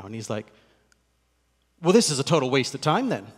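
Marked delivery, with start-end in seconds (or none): 1.8-3.3 louder, blunt attorney brush-off; curt dismissal. Retold or imitated. Attorney tells the pastor the meeting is a waste, blunt and louder. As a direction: dismiss the whole meeting as pointless. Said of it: "That's exactly how he said it, too".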